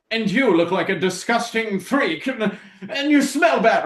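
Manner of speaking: high, loud mock-posh sneer; posh snob. Posh fighters on the other ship insulting the newcomer, hammed-up haughty sneer. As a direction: sneer it with your pinky up. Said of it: "they're doing their posh shit".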